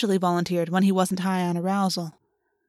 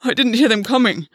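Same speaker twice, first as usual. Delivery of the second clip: louder, gasping self-defence; gasped; 3 semitones higher, 5 dB louder than usual. Acted. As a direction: gasp out a desperate excuse. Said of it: "Gwen gasped".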